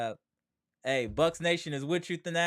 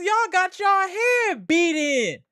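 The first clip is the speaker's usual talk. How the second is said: high, loud, slow jeering shout; gloating taunt; 11 semitones higher, 5 dB louder, slower than usual. Real, not acted. Streamer taunting Celtics fans, slow high jeer. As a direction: rub it in their faces.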